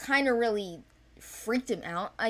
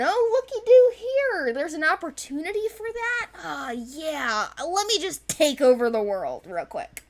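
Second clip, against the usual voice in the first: higher and louder, gleeful mock scheming; mock gleeful villain; 7 semitones higher, 5 dB louder than usual. Mocking the king's power grab with gleeful glee. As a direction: gush like a cartoon villain grabbing power.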